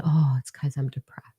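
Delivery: a hushed, secretive whisper; whispered self-diagnosis. Acted. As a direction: breathe it like a guilty discovery. Acting out googling her symptoms.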